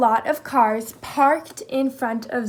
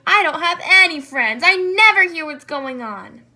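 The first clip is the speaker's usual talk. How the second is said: loud, higher, furious complaint; angry yell; 4 semitones higher, 7 dB louder than usual. Acted. Jackal yelling at Zebra that it's not fair, angry and loud. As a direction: shout it out like a jealous child. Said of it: "he gnashed his teeth and yelled".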